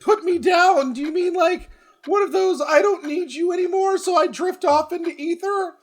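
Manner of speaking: squeaky high-pitched teasing; high mock voice.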